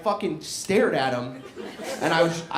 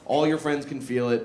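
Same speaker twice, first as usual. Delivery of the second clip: ordinary talk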